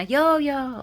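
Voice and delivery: high sing-song pet name; calling the cat's name. Retold or imitated. Owner calling her cat by its pet name, high and fond. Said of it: "that's how I would say her name".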